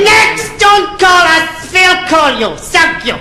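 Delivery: very high, dismissive interviewer; Nicky Lauder. Acted. Airline boss dismissing the first applicant, brusque. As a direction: send them away in a high, stretched-out voice. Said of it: "And Nicky Lauder said".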